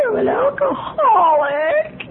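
slow, drawn-out mock sob; tearful wail. Newcomer admitting a drinking problem, in a slow wail. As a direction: wail the confession like it's the end of the world. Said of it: "It was the saddest moment in my life".

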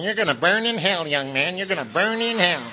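lightly voiced fire-and-brimstone preacher. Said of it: "minister out there going".

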